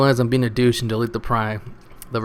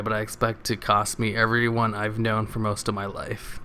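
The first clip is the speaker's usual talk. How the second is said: ordinary talk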